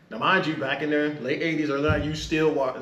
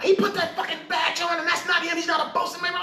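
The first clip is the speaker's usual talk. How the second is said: higher, shouted mock complaint; mock angry chief; 12 semitones higher than usual. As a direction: play the chief fuming to the captain.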